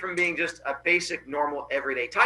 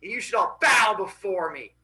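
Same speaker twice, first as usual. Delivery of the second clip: proud boast; 3 semitones higher, 3 dB louder than usual. Acted. Boy bragging to bullies about his father, grand and boastful. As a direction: announce it like mock royalty. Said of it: "He goes".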